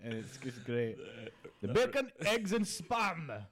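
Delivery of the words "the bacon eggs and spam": put-on high, drawn-out Spanish-English accent; Spanish-tinged English accent. Spanish waiter reading an English breakfast menu, hammed-up Spanish-English accent. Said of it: "got a hint of Spanish in it".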